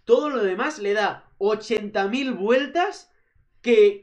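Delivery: normal talking voice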